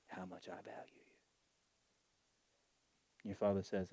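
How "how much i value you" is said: soft whisper. Acted. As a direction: whisper it tenderly, almost no voice. Tender whisper to listeners.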